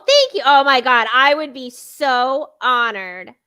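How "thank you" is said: delighted thanks. Real, not acted. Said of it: "delighted thanks".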